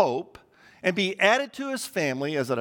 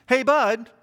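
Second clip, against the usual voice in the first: higher-pitched, attention-getting call; questioning call; 5 semitones higher, 4 dB louder than usual. Retold or imitated. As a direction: imitate a friend about to ask something. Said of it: "meant he had a question".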